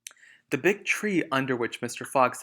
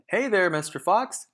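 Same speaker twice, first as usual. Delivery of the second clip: higher, louder taunting call; yell; 7 semitones higher, 7 dB louder than usual. Acted. Bunce yelling to Mister Fox from his tractor, taunting. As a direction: hail him mockingly. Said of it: "yelled Bunce".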